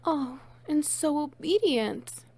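pleased surprise at the pet; delighted gasp. Acted. Delighted by obedience.

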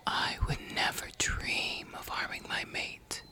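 whispering. Acted. Whispered reassurance to captive.

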